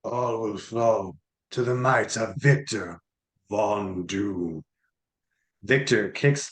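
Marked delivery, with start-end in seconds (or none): 0.0-4.6 slow, low gloating tyrant; dark lord. Acted. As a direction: proclaim it like a conqueror. Villain proclaims his power.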